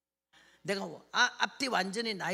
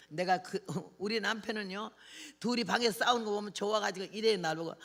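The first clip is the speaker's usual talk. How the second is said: ordinary delivery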